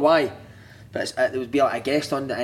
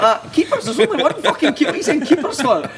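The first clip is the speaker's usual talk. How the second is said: high, louder scoffing through laughter; mock incredulous; 12 semitones higher, 5 dB louder than usual. Player mocking the chairman's "keepers", laughing and incredulous. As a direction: scoff at the word like it's absurd, laughing. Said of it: "And I was like".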